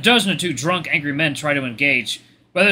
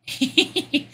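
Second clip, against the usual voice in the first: high wheezy giggle starting over and over; engine-like laughter; 14 semitones higher than usual. Acted. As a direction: laugh in stuttering bursts like an engine turning over. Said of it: "laughing like a car engine turning over".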